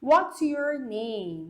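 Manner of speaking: "what's your name" drawn out very slowly; slow demo question. Shown as a demonstration. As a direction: say each word slowly for learners. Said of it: "Então olha aqui".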